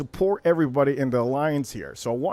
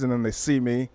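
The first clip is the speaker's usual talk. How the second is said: usual speaking voice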